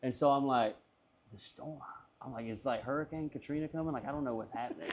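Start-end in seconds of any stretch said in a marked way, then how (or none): 1.3-4.9 much softer, muted bewilderment; bewildered. Retold or imitated. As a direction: mumble it to yourself, baffled. Acts out his drunk bewilderment, muted.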